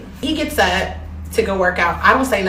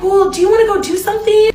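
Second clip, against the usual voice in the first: coaxing guy voice; 10 semitones higher, 4 dB louder, faster than usual. Retold or imitated. Man coaxing her into going out.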